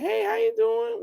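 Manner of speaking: very high falsetto imitation of a woman; soft, sweet woman's voice. Imitating a woman friend answering his phone call, soft and sweet. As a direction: put on a sweet, high-pitched female voice. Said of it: "she had a real soft and sweet voice".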